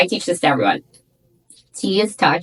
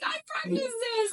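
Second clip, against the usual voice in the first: much higher, small and thin, laughing a little; high squeaky voice; 10 dB quieter than usual. Shown as a demonstration. Mocking her old self to the hosts, in a high squeak. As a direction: squeak it out like a timid girl. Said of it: "I used to talk like this".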